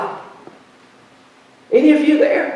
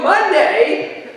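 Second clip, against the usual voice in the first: higher, laughing self-parody of a big vow; laughing mock pledge; 6 semitones higher, much slower than usual. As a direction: laugh through your own empty promise. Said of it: "And I'm going".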